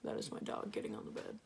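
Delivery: whispering. Real, not acted. Aside about dog, whispered.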